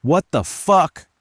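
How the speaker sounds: high, loud, slow exclamation; shouted. A narrator's reading. Narrator ducks a hurled lamp, shouting. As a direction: shout it in shock, drawn out. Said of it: "I shouted".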